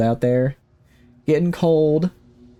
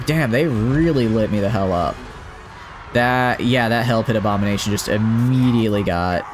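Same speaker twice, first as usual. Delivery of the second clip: ordinary talk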